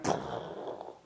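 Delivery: long unvoiced blown-out crash sound; imitated crash noise. Vet shows patient crashing after booster, imitated crash noise. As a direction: blow out a noise of sudden collapse.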